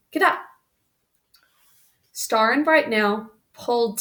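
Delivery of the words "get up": shouted command. A narrator's reading. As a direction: crack the whip and call it out. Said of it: "cracked his whip and shouted".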